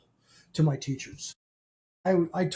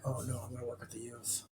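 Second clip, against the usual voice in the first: much softer, quick, dismayed "oh no"; quiet dismay; 4 semitones lower, 15 dB quieter, faster than usual. Retold or imitated. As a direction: mutter it to yourself in mild dread. Dismayed at the youth calling.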